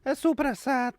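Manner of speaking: high, loud hammy Italian cry; mock Italian-American accent. Co-host adding deli meats, exaggerated Italian-American yell. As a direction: belt it like an Italian deli man.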